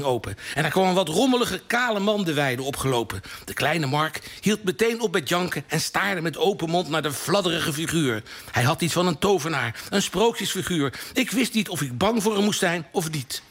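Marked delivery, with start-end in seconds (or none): none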